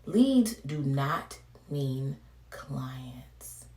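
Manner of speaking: slow unvoiced whisper. Real, not acted. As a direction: whisper it slowly, like a secret. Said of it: "whispered aside".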